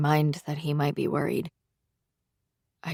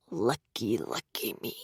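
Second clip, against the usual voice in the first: bitter fading murmur; 8 semitones lower, 10 dB quieter than usual. Acted. Cole bitterly repeats lucky, fading.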